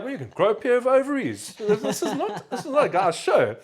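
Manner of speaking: high, loud mock protest; hammed-up retort with a laugh in it; retold comeback. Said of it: "And I'm like".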